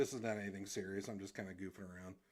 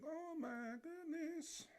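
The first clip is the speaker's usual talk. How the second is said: much higher and slow, drawn out; slow dismay; much slower than usual. Retold or imitated. Streamer voicing the suited cartoon guy, slow squeaky dismay. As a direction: stretch it out in a squeaky voice. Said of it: "He's all like".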